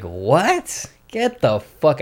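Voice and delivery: retold mocking disbelief; making fun. Telling how he answered "where you from", making fun of them. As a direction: lightly scoff in mock disbelief. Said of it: "I start making fun".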